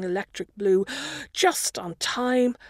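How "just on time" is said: breathless after running for the bus; panting through the words; out of breath. A narrator's reading. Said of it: "I'm out of breath again".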